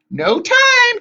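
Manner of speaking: very high, loud hag's cry; urgent cry. A narrator's reading. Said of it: "Tempest cried".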